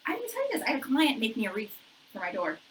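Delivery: ordinary talk, nothing marked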